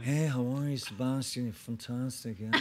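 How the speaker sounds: lightly voiced Irish film star greeting; impression of an Irish star, mildly done; Liam Neeson. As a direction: imitate a gracious actor saying hello. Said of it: "You do these Liam impressions".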